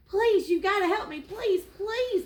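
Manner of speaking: high-pitched, urgent gazelle pleading; nervous begging. Acted. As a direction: plead loudly, pitch leaping up on each please. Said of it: "begged the gazelle".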